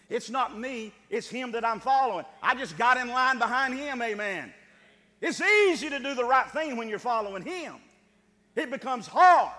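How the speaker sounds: loud, driving preacher's build; preaching at full force. Acted. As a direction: hammer it home to the congregation.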